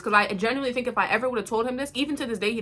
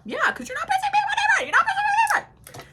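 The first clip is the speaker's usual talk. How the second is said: very high, squeaky taunt; mock triumphant squeal, fast at the end; taunting parting shot; faster than usual. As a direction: crow after them in a high-pitched squeal. Taunting as they leave.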